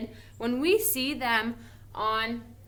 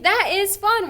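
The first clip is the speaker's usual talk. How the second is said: much higher and louder, thrilled; excited statement; 10 semitones higher, 9 dB louder than usual. Shown as a demonstration. Teacher showing how an exciting statement sounds. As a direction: say it with real excitement. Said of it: "saying it kind of in an excited manner".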